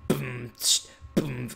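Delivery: low beatbox boom and hiss; beatbox a bass beat by mouth; vocal bass drops. Pretend. Player fakes his sound system, low beatbox. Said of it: "the sound of me dropping the bass".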